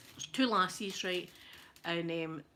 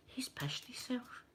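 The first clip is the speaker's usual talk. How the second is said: unvoiced whisper to daughter; 2 semitones higher, 9 dB quieter, slower than usual. Mother whispering to her daughter about the drunk man, hushed. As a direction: hush it behind your hand.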